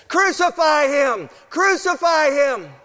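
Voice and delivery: high, drawn-out crowd chant at full shout; shouting mob. Acted. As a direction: shout it slowly like a crowd demanding blood. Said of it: "they were shouting different things".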